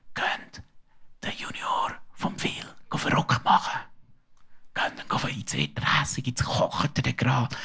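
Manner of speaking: breathy ghost whisper into an ear; the ghost whispers to his friends, a sly whisper. Acted. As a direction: whisper like a sly little ghost stirring trouble. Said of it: "und flüstert ihnen ins Ohr".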